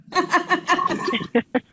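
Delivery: very high laughing squeal. Real, not acted. Laughing after thanks.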